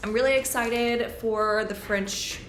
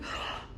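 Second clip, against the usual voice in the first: 1 excited gasp; 12 dB quieter than usual. Retold or imitated. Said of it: "I'm like".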